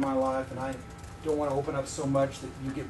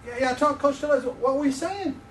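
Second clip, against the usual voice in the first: eager; 9 semitones higher, 5 dB louder than usual. Shown as a demonstration. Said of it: "let me try this again".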